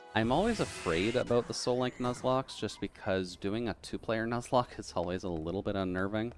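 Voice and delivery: usual speaking voice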